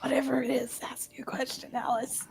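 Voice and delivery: unvoiced hushed aside; whisper. Real, not acted. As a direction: whisper it to your friend.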